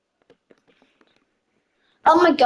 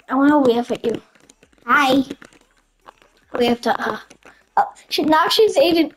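normal talking voice

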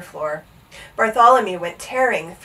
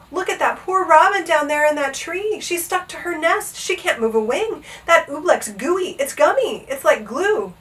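high agitated exclaiming; crying out; 7 semitones higher than usual. A narrator's reading. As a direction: point it out in high-pitched alarm. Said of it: "Bartholomew cried".

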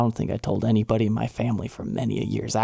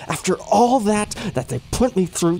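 high-pitched, exasperated indignation; indignant protest; 9 semitones higher than usual. Acted. Protesting the ruling after all PayPal put him through, indignant. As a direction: protest the injustice in a raised pitch.

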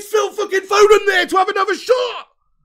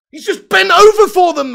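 exasperated yell; 2 semitones higher, 10 dB louder than usual. Real, not acted. Furious fan ranting at match.

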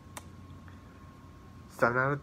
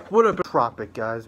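shouted energetic hello; loud greeting; 3 semitones lower, 8 dB louder than usual. Real, not acted. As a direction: call out the greeting with hype. Loud greeting opening review.

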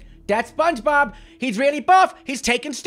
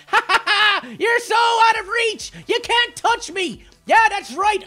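taunting; 7 semitones higher, slower than usual. Real, not acted. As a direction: laugh in his face and gloat. Taunting enemy from carpet.

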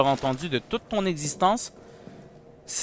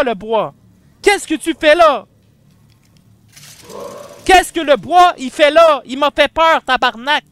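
loud, high panicked shouting at the monster; scared yelling; 9 semitones higher, 9 dB louder than usual. Real, not acted. Startled by the monster, yelling in fright.

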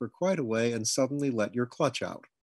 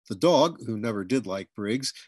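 ordinary delivery

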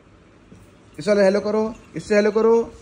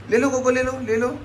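coaxing the dog; 6 semitones higher than usual. Real, not acted. Offering toy to dog.

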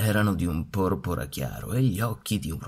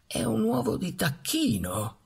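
put-on high, exclaiming old duck voice; an old duck crying out in alarm; vecchia anatra esclama; 12 semitones higher than usual. A narrator's reading. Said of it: "esclamò la vecchia anatra". (vecchia anatra che esclama con voce acuta)

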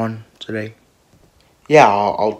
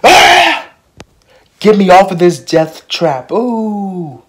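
high scream into a loud mock plea; high-pitched retold yell, trailing into a long ooh; imitated yelling; 9 semitones higher, 6 dB louder, much slower than usual. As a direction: imitate a panicked rider yelling to get off. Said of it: "you kept on yelling".

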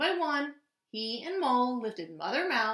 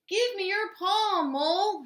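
raised, higher urgent call; mild shout; 9 semitones higher, 4 dB louder than usual. A narrator's reading. Hedgehog reaches for Mole in water, mild shout. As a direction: call urgently to a struggling friend. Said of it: "shouted Little Hedgehog".

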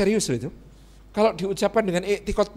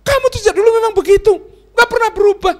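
loud high-pitched rapid scolding; angry wife; 10 dB louder, faster than usual. Acted. Wife scolds husband for never changing, loud angry outburst. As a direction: hurl the complaint, high and fast. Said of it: "kalau satu saat marah kepada bapak-bapak".

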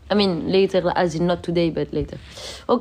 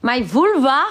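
surprised question; 4 semitones higher, 4 dB louder than usual. Real, not acted. Repeating a viewer's odd comment.

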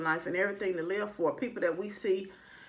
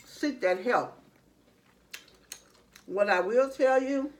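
speaking while chewing food; 5 semitones higher than usual. Real, not acted. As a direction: mouth partly full. Seen 2: Bite at -3; chewing through words; hand to nose near end.

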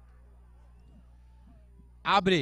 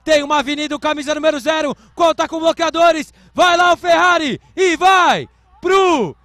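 louder, higher, stretched-out goal-style yell; touchdown call; 8 semitones higher, 13 dB louder, slower than usual. Real, not acted. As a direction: roar the touchdown to the crowd. Touchdown run in live play-by-play.

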